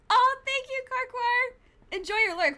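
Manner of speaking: high, loud southern thank-you. Acted. Thanking a lurking chat viewer in a Southern accent. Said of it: "my southern voice".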